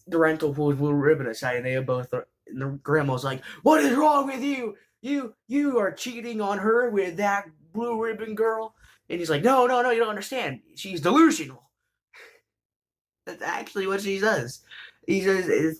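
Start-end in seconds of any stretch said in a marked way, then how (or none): 3.6-8.8 put-on high, strained old-lady scold; scolding grandma. Grandma scolding her grandson for cheating, in a high shocked voice. 9.4-11.7 flustered denial. Retold or imitated. Grandson panicking before grandma.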